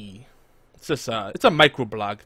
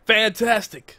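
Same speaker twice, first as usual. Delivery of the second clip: high, laughing catchphrase shout; Christopher Eccleston; 10 semitones higher, 6 dB louder, slower than usual. Retold or imitated. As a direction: toss off a famous Doctor's catchphrase. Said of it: "playing the Christopher Eccleston, uh, soundboard clip".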